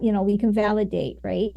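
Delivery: ordinary talk, nothing marked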